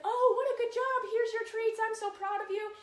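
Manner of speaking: high, loud, over-the-top gushing; gushing praise. Shown as a demonstration. Trainer shows the gushing praise some dogs want, high and loud. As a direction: throw them a party with your voice. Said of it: "So that it's like".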